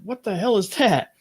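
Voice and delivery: high, incredulous retold question; retold disbelief. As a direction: act out being taken aback. Retold shock at removed blob.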